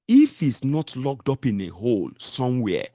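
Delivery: ordinary talk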